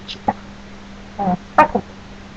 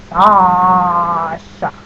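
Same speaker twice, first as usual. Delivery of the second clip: "Sasha" with a very long first vowel; drawn-out Sasha; 2 semitones lower, 5 dB louder than usual. Shown as a demonstration. Giving the username to viewers, "Sasha" heavily drawn out on the first syllable. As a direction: stretch "Sasha" out slowly, holding the first "a". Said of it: "There is a distinct difference".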